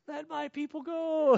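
put-on a toddler's triumphant answer; little daughter's voice. Hidden daughter answering from the closet in a high little voice. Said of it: "we hear this".

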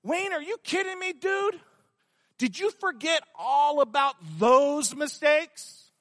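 mock him like a bully; the enemy mocking Wayne's past, high and scornful; mocking accuser. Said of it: "it becomes".

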